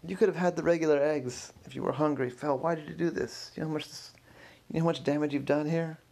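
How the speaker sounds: upset confrontation. Acted. Curator confronting Phil over the damaged egg, upset and reproachful. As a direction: demand an explanation in dismay.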